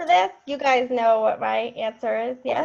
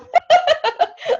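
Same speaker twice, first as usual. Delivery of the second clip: high burst of laughter; 6 dB louder than usual. Real, not acted. Leader laughing at her own joke about the team. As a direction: laugh out loud high and bright.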